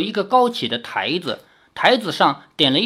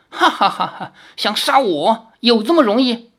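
laughing, mild taunt; shrill mocking laugh; 5 semitones higher than usual. A narrator's reading. Fox demon laughs at Li Xiaoyao's threat, mocking. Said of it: "狐妖尖声长笑".

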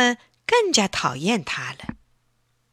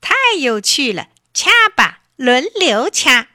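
gleeful shout; 5 semitones higher, 6 dB louder than usual. A narrator's reading. Big crab shouting with glee to take turns pinching. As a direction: crow it out with delight. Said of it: "大叫起来".

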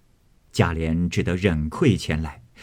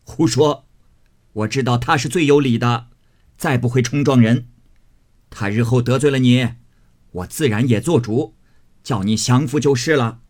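amused scolding; 4 semitones higher, 4 dB louder than usual. A narrator's reading. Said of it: "贾母笑道".

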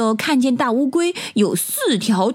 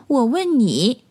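usual speaking voice

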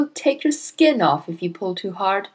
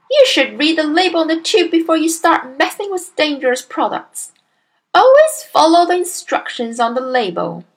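higher-pitched, lecturing tone; scolding wife; 7 semitones higher, 5 dB louder than usual. A narrator's reading. Wife to husband on dangerous products, lecturing. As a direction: lay down the rule bossily.

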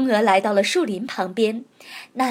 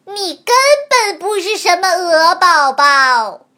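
crowing rooster voice; 5 semitones higher, 7 dB louder than usual. Acted. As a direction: bellow it like a crowing cock. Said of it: "像打鸣一样扯着嗓子".

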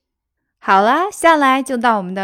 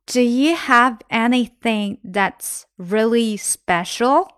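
slow, careful model reading; slower than usual. Shown as a demonstration. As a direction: spell the sentence out slowly for learners. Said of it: "teacher slows the sentence for learners". (放慢速度示范朗读)

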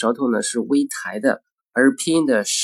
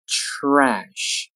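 "Trash" stretched through to the sh; slow careful "Trash"; drawn-out model. Shown as a demonstration. Teacher models word slowly, then normally.

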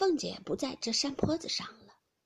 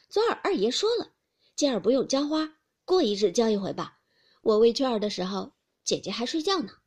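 louder, defiant comeback; sharp retort; 7 dB louder than usual. Acted. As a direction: fire back at the accusation. Hongyu retorting.

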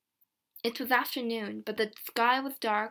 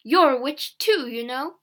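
angry shout; 2 semitones higher, 7 dB louder than usual. A narrator's reading. Mother turns on her daughter, shouting. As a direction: accuse the child loudly. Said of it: "my mother shouted".

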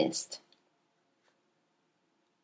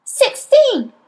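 put-on much higher, louder cheery child answer; foolish abandon; 6 dB louder than usual. A narrator's reading. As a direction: blurt it out carelessly. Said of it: "with foolish abandon".

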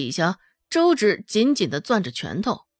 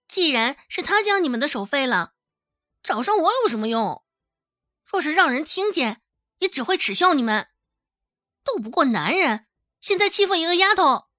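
put-on higher-pitched girl talking back; higher, quicker girlish retort; defiant girl; 6 semitones higher than usual. A narrator's reading. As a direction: challenge the bullies, quickening as you go. Girl taunts the angry thugs.

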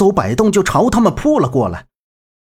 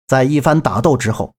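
normal talking voice

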